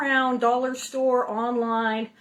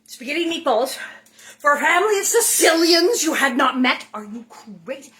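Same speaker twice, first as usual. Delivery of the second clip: imitating an appalled dad, building to loud; incredulous father; 3 semitones higher, 7 dB louder than usual. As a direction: scold in mock disbelief, peaking on the Sicilians. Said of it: "Or as my father said".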